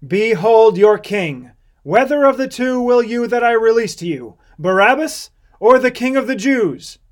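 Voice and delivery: loud, high address to a crowd; public proclamation. A narrator's reading. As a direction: put the choice to the crowd in a ringing voice.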